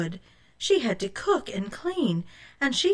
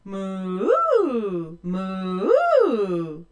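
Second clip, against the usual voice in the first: cow mooing; 5 dB louder than usual. A narrator's reading. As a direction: imitate a cow, drawn out. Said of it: "The two-headed cow mooed".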